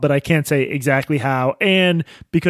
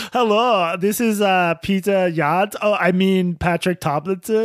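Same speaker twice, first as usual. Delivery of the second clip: high-pitched put-on caller voice; hammed-up fake phone greeting, pitched up; mock prank caller; 5 semitones higher, slower than usual. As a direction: play a hoax caller fumbling the name. Joking impression of the swat caller.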